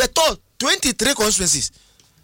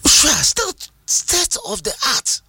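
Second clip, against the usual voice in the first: emphatic repeat; 8 semitones higher, slower than usual. Real, not acted. Repeats phrase, pointedly drawn out.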